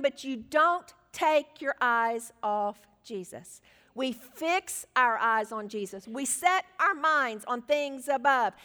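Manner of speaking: slow, weighty exhortation; slow right down and land each word firmly; emphatic preaching. Real, not acted. Preacher urges people to fix eyes on Jesus, emphatic. Preacher drives the point home.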